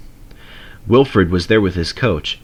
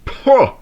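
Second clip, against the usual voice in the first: scornful snort; 4 dB louder than usual. A narrator's reading. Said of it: "snorted Lord Harry".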